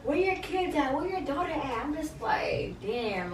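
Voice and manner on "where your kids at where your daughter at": higher, slow mock heated jab; hammed-up heated argument voice; imitating a heated argument. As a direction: act out the taunt, pitched up and dragged. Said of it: "some heated ass argument".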